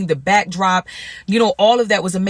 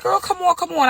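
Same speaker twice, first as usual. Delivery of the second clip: put-on high coaxing girl voice, pitched way up; homegirl coaxing; 9 semitones higher than usual. Friend urging her to come along, high playful coaxing.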